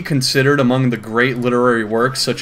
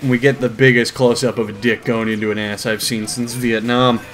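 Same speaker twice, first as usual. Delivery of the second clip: usual speaking voice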